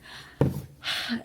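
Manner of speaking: long breathy sigh of relief; relieved sigh. Real, not acted. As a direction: let out a big unvoiced sigh. Relieved after the day.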